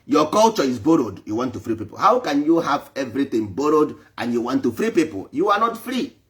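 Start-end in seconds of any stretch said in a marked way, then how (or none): none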